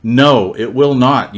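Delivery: ordinary talk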